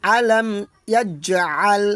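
ইয়াজআল with an echoing জ; qalqala. Shown as a demonstration. Teacher drills the echo on jim. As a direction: bounce the জ in ইয়াজআল. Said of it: "তাহলে কীভাবে বলবো".

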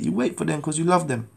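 usual speaking voice

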